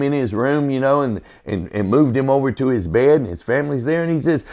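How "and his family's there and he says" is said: ordinary talk, nothing marked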